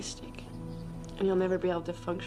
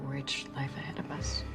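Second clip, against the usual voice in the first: whispering; 5 semitones lower than usual. Acted. Secret lovers plotting quietly.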